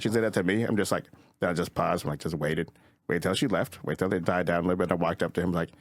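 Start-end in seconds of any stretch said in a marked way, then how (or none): none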